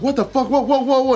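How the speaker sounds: a driver's high shocked cry. Retold or imitated. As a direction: panic at a crash happening too fast. Acting shocked driver at crash.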